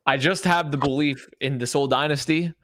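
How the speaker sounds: ordinary talk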